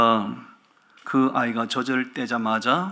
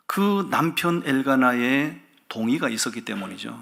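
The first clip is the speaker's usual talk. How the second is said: ordinary talk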